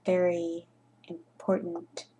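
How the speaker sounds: slow, hushed mock-important tone; hushed mock gravity; mock grave. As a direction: whisper it like it matters hugely, tongue in cheek. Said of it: "Well, not really".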